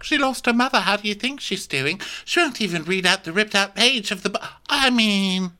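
high-pitched mock-tearful complaint; upset. Daughter upset about her bereaved friend, mock-tearful. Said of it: "she's upset, obviously".

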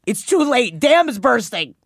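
loud, high gushing; excited shouting. Real, not acted. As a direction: gush over it loudly. Gushing over the chapter.

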